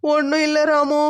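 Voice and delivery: slow, drawn-out tearful whine; acted weepy denial. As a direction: draw the words out through crying. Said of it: "பிறகு எதுக்கு அழுதுகிட்டே இருக்க".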